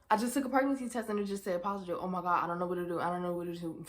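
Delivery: fast, frantic rambling; rushed panic. Retold or imitated. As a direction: rush it out in a panic. Frantic call to boyfriend.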